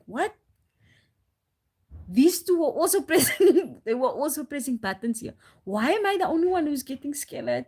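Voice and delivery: high, loud indignant mock protest, laughing through it; angry protest. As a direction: retell snapping back at the worker, half laughing. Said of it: "how angry I got".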